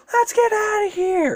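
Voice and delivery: high, panicked cry; panicked flee. Acted. Followers fleeing the serpent, high and panicked. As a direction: scream to run away. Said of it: "Baku's followers are like".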